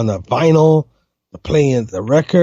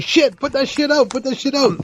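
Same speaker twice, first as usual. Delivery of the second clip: mock panic; 11 semitones higher, 3 dB quieter than usual. Retold panic over burning underwear.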